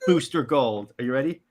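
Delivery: ordinary talk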